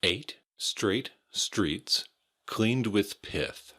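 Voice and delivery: slow, word-by-word tongue twister; slow and carefully articulated. Shown as a demonstration. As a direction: take the tongue twister slowly, separating each word. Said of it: "a little bit of a tongue twister".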